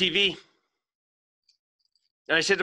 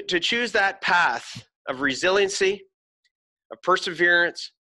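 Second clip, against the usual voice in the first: ordinary talk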